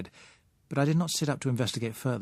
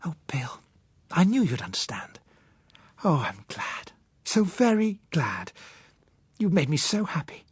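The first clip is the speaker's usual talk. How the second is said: put-on higher young woman's voice, glad and grateful; Josella, overjoyed; 5 semitones higher than usual. A narrator's reading. Josella thanking Bill for understanding, glad higher voice.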